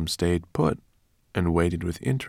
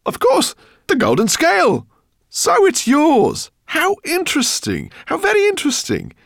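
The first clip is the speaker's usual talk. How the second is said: much higher, louder fussy professor voice; excited professor; 9 dB louder than usual. Acted. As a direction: gush with fake fascination to stall. Professor stalls the dragon excitedly.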